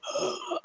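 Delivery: imitated shocked gasp; sharp intake of air, mimicking a crowd; audience gasp. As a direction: gasp as if scandalized. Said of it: "like, gasp from the audience".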